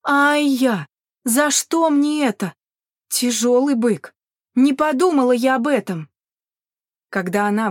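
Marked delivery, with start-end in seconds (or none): 0.0-6.1 louder, higher moan with drawn-out words; groaning complaint.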